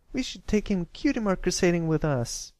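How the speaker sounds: high, bright-eyed filly exclaiming an idea. A narrator's reading. Sweetabelle proposing to take Pipsqueak along, excited. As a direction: blurt it out eagerly, pitched high. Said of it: "Sweetabelle exclaimed".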